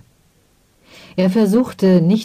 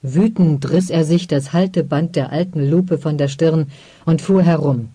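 ordinary delivery

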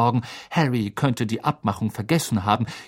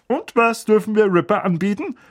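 higher, louder hearty host voice; jovial uncle; 9 semitones higher, 3 dB louder than usual. Acted. Uncle offering his sister's dog something, jovial. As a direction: play the beaming host. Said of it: "fragte er".

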